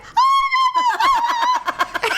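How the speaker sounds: very high mock wail, laughing; upset mom imitation. Imitating mom seeing maggots in cut fly, horrified. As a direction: imitate a mother's horrified shriek. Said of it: "never seen her so upset".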